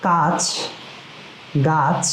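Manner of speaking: each word spoken slowly and cleanly, with a pause; clear drill words. Shown as a demonstration. Teacher modelling words for the class, deliberate. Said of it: "আমার সাথে সাথে আপনারা কিন্তু সেটি পড়বেন".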